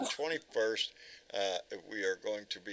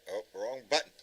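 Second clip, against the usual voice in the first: usual speaking voice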